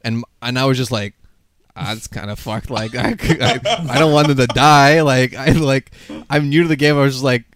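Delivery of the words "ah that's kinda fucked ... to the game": loud upset protest. Retold or imitated. Retelling own upset reaction.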